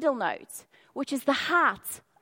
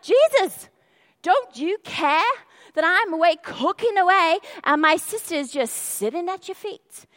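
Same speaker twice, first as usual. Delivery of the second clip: Martha's exasperated outburst, higher and louder; annoyed complaint; 5 semitones higher, 6 dB louder than usual. Acted. Annoyed Martha telling her honored guest off, exasperated and loud. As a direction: tell off the guest in a huff, louder. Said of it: "Martha gets so annoyed at this".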